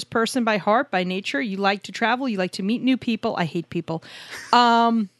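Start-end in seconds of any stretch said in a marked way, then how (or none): 4.5-5.1 caught out. Real, not acted. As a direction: blurt a flustered apology. Catches herself after gaffe.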